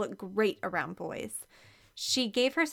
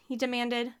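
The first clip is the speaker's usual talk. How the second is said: ordinary delivery, nothing marked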